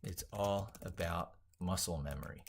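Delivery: usual speaking voice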